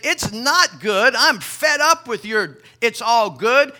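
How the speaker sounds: landowner's loud, slow, stern retort. Retold or imitated. Landowner scolding his servant, loud and stern. Angry landowner voiced in story.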